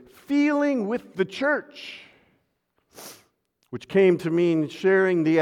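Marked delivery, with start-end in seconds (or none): 0.1-2.0 feeling with the church lifted high and slowed; set-off phrase. Shown as a demonstration. Pastor quoting Romero's phrase, lifted high and slow. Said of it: "coined the phrase".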